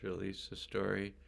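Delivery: ordinary delivery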